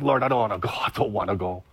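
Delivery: low, sinking plea to God; reluctant prayer. Retold or imitated. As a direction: pray it like you're dreading it. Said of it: "I'm praying".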